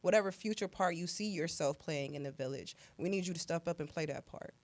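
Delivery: ordinary talk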